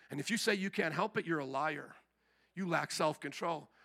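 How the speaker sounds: quiet, low-key confrontation; casual, hushed and matter-of-fact; soft-spoken. Acted. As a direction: deliver a hard warning softly, without any shouting. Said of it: "There's no shouting there".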